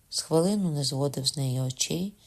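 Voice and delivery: ordinary delivery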